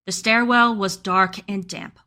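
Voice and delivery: ordinary talk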